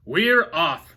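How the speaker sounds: loud cheer; all shouting. A narrator's reading. Crew and boy shout as they sail, loud cheer. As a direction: shout it together. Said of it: "we all shouted".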